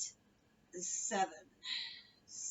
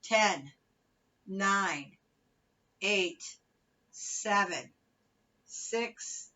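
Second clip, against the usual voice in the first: aerobics instructor; 4 dB louder, much slower than usual. Acted. Fitness cueing and countdown.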